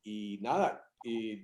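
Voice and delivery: ordinary talk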